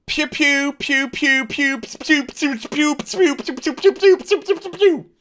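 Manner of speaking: high-pitched rapid pew-pew imitation; blaster-fire noises. Narrator imitating fire from outside, high blaster noises.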